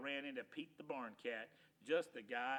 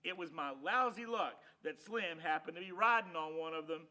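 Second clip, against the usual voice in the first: ordinary delivery, nothing marked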